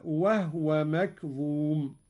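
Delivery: وهو with silent ha, said wahwa; Qalun reading. Shown as a demonstration. Teacher demonstrating Qalun's reading: وهو with silent ha. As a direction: recite وهو as wahwa, the ha unvowelled. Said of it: "هكذا".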